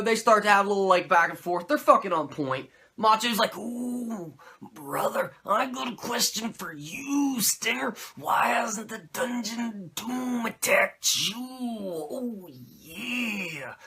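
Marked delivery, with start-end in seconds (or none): 3.6-13.7 Macho Man impression. Macho asking Sting about the Dungeon of Doom, in a growling Macho Man voice. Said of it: "you gotta cough after you do that voice".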